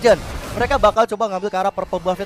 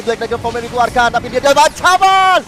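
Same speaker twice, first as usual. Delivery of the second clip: high, fast, rising hype commentary; fighting game announcer; 9 semitones higher, 4 dB louder than usual. Acted. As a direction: call the teamfight at full hype. Rising live play-by-play on teamfight.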